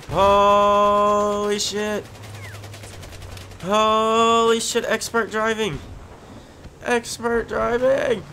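high, loud, drawn-out holler; excited mocking yell. Real, not acted. Player hollering sarcastic praise at a driver's stunt. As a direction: crow over the wild driving. Mocking bad driving while yelling.

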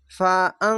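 ফা আং with a held nasal ং; ikhfa ghunnah. Shown as a demonstration. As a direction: hum the ং in ফা আং through the nose. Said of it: "ইকফা গুন্না one স্কারের মতো উচ্চারণ হবে". (ফা আং-এর ং নাকে টেনে গুন্না)

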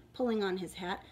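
usual speaking voice

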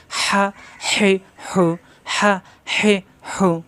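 হা, হি, হু each short with a breathy squeeze from mid-throat; no stretch. Shown as a demonstration. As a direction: push out হা, হি, হু one by one without drawing out. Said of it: "কোনো টান নাই". (টান ছাড়া গলা চেপে হা, হি, হু)

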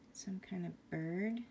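ordinary delivery

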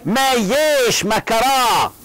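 high, drawn-out cry of surprise; slow, exaggerated surprise for a class demonstration; astonishment. Shown as a demonstration. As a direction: stretch it out in astonished disbelief. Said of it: "باستغراب يعني. كلها".

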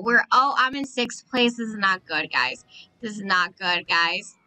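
ordinary delivery

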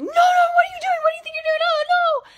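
put-on a mother screaming to protect her baby; panicked scream. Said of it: "screamed at me, like, absolutely freaked out".

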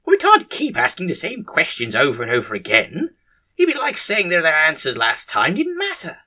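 high, emphatic objection; exasperated protest. Acted. Head surgeon refusing to ask managers again, high exasperated protest. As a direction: protest as if the idea is absurd.

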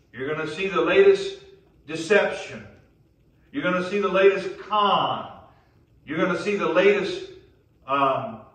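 fired-up sermon delivery; impassioned preaching. Real, not acted. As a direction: preach it hard, driving each phrase to the congregation. Preacher warming to full force.

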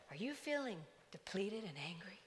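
soft, hushed announcer tone; calm loudspeaker voice. Retold or imitated. Walmart loudspeaker asking shoppers, calm and soft. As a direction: echo the gentle PA voice softly. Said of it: "A very calm voice over the loudspeaker".

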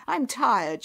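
a loud, fed-up complaint; cross complaint. Acted. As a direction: put some force into the grumble. Rude, tired Nyap snaps at relatives.

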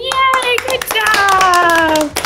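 high, drawn-out congratulating cheer; excited cheer. Real, not acted. Teacher praises children after their song, cheering. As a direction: cheer the class on for finishing.

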